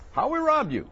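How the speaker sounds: mock indignant complainer. Preacher mimics Israel doubting God's love, whiny mock protest. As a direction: squeak out a petulant complaint. Mocking the people questioning God.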